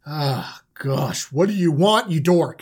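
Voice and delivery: low, weary put-down; exasperated brothers. Acted. Brothers answering the dork's meeting call, wearily exasperated. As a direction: groan it out at an annoying sibling. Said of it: "And everyone's like".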